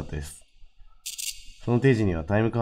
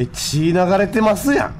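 alarmed; 9 semitones higher, 4 dB louder, slower than usual. Real, not acted. Sees blood in horror game.

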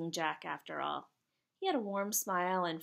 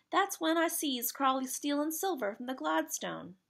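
higher, lightly acted storytelling lad; a hint of a working boy telling what he saw; lightly voiced Jack; 7 semitones higher, 3 dB louder than usual.

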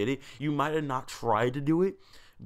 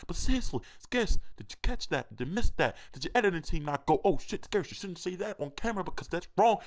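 high mock outrage; 6 semitones higher than usual.

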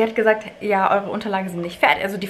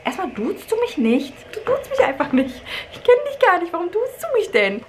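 indignant retort; 10 semitones higher than usual. Retold or imitated. Mother snapping back at the rude office clerk, rising outrage. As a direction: pitch climbing higher, outraged. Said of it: "ich bin wirklich sauer geworden".

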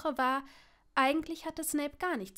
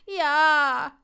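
loud, drawn-out whine; hammed-up miserable moan; wailing; 3 semitones higher, 12 dB louder than usual. A narrator's reading. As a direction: wail it out pitifully at full volume. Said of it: "jammerte Seamus".

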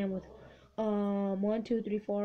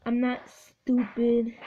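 ordinary delivery

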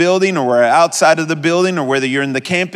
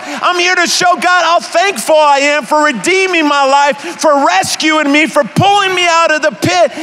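much higher, loud, defiant shout; 12 semitones higher, 3 dB louder than usual. Retold or imitated. Preacher answering critics with a defiant shout. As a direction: belt it out, not caring who hears. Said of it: "And I'm like".

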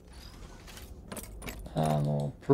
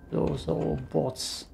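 slow, quieter deadpan repeat; mock deadpan; 4 semitones higher, 4 dB quieter than usual. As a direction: say it again like a dry aside.

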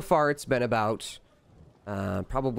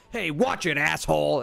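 higher, irritated callout; annoyed shout; 4 semitones higher than usual. Acted. Crowd member to man pushing through, irritated callout. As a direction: call out at someone shoving past.

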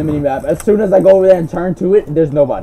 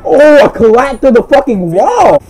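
excited shout; 8 semitones higher, 7 dB louder, slower than usual. Real, not acted. Excited at a kill.

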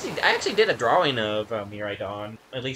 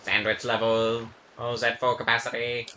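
robot voice; 3 semitones lower than usual. Acted. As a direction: speak like a deadpan machine.

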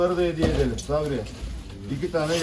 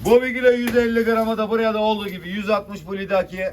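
loud auction call; auctioneer; 5 semitones higher, 5 dB louder than usual. Real, not acted. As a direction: call the lot to the room. Fish auction bidding call.

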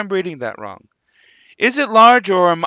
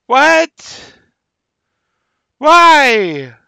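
loud, high baffled what and why; loud disbelieving cry; 8 semitones higher, 9 dB louder than usual. Real, not acted. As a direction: blurt it out, totally thrown. Baffled by Shrek appearing.

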